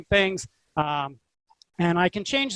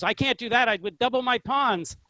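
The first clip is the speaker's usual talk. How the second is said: higher-pitched balking student; mock protest; 5 semitones higher than usual. Student refusing the coach's winning move, mock protest. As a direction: object in a raised, put-on voice. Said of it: "He hated them with a passion".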